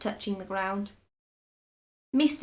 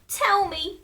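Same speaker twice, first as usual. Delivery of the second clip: very high, loud begging; eager cry; 11 semitones higher, 6 dB louder than usual. A narrator's reading. Frightened wife begs very high to be told. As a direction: plead high and urgent. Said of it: "she cried".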